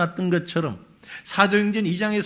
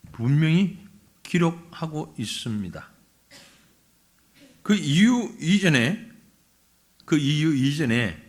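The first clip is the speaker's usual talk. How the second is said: normal talking voice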